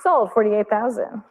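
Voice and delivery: brisk auction calling, closing the lot; fine art auctioneer. Real, not acted. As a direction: call the last bids like an auctioneer.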